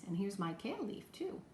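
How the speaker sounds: normal talking voice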